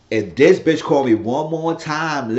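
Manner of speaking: imitating an exasperated woman's rant; fed-up angry outburst. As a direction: snap it out slowly like she's had enough. Esperanza fed up with calls.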